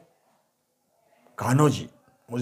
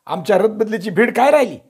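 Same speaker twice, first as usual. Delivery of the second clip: sulky reproach; 7 semitones higher, 5 dB louder than usual. A narrator's reading. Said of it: "रुसाईच्या बोलीनं Kanhoji बोलले".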